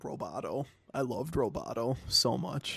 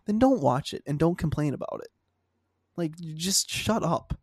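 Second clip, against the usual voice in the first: louder, fed-up shut up; angry rant; 5 semitones higher, 5 dB louder than usual. Real, not acted. Ranting at fans.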